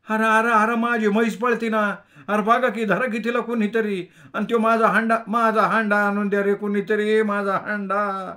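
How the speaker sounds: high, loud rustic wail; wailing milkmaid. A narrator's reading. The milkmaid wails for her runaway buffalo and pot. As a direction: cry out for help like a village milkmaid. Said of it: "ती गवळण कणत म्हणाली".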